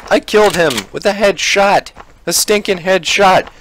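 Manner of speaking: high-pitched, thrilled exclamation; excited triumph. Real, not acted. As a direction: crow over an amazing kill. Celebrates a headshot kill.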